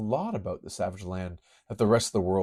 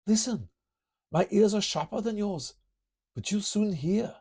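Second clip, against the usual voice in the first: lightly higher, measured hero voice; higher character voice; 14 semitones higher than usual. Acted. Kurt warns his teammate, higher measured voice. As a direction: speak as the keen-eared teammate.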